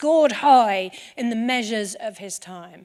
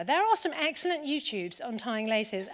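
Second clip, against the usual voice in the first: ordinary delivery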